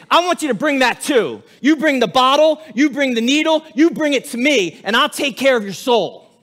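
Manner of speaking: impassioned voice of Jesus inviting sinners; fervent plea. Acted. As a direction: urge them to bring it all. Preacher voicing Jesus, rising fervor.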